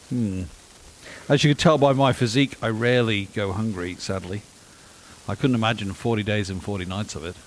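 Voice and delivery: normal talking voice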